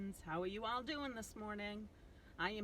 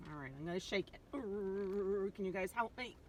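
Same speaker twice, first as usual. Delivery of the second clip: ordinary delivery, nothing marked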